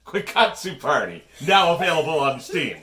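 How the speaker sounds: loud, pushy sales pitch; infomercial. Pretend. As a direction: close the deal like a TV ad. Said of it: "like an infomercial".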